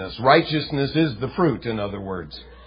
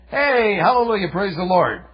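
a hint of breezy hallelujah; mock cheer; 6 semitones higher than usual. Preacher mocking what Jesus did not say, lightly cheerful. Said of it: "He wasn't saying".

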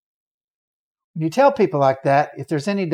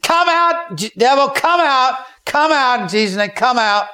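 loud, high, insistent command; much higher shouted command to come out; commanding exorcism shout; 9 semitones higher, 5 dB louder than usual. Retold or imitated. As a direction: order the devil out at full voice. Preacher casting out demon.

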